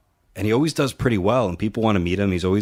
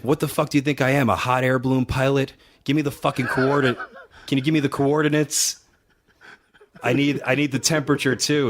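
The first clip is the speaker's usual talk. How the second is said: higher, faster mock tirade imitating Scott; mock Steiner rant; 5 semitones higher than usual. Promoter imitating Scott ranting about coordinates, mock sarcasm. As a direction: ham up his sarcastic complaint.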